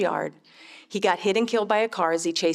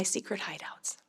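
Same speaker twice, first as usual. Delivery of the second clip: whispered secret; 12 dB quieter than usual. Acted. Secret hideouts line in book.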